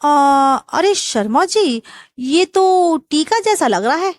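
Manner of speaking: hammed-up mock surprise; fake surprise. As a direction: stretch the words as if shocked by the stain. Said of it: "हैरानी दिखाते हुए".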